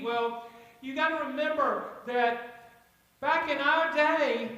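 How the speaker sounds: ordinary talk, nothing marked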